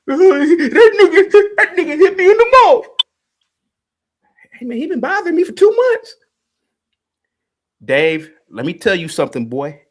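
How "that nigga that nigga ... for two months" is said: much higher, louder whine broken by a laugh; mocking crybaby voice. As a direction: ham up a whimpering crybaby complaining to the others. Mocking the caller after being punched.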